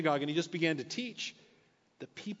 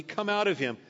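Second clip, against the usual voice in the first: firm and louder, stress on out; stern command; 5 semitones higher, 5 dB louder than usual. Retold or imitated. Jesus commanding the spirit to leave, stern. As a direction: order the spirit out. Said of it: "said Jesus sternly".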